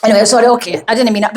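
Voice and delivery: ordinary talk, nothing marked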